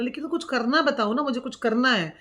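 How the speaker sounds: impatient son demanding action; insistent demand. Retold or imitated. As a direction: press him for something to do.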